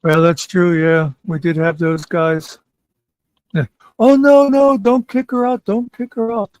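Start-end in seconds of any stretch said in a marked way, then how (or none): 4.0-6.5 high-pitched hammed-up plea, loud at first then easing; mock pleading. Mimicking guys who begged to keep her, mock pleading. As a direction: imitate panicky defenders, pitched well up.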